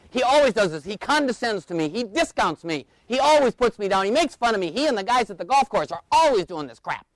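high, indignant tirade; imitated yelling wife. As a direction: rant like a wife listing grievances. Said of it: "she starts yelling at him about his behavior".

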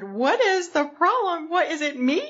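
high, upset, puzzled voice; anxious coworker. Retold or imitated. New secretary asking why she's ignored, high and worried. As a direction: pitch up as if hurt and confused. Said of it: "and she said".

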